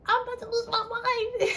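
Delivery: high, loud desperate cry to mom; hammed-up panicked wail; dramatic wail. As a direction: wail it like it's the end of the world. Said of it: "she know I'm dramatic".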